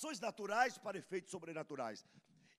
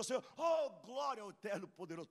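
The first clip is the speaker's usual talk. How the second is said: mock pious Moses; 5 semitones higher, 5 dB louder than usual. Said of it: "Moisés chega para o Senhor".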